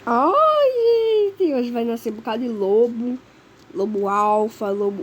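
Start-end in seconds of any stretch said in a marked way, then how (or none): 0.1-1.5 panicked cry. Real, not acted. Night falls, wolves coming.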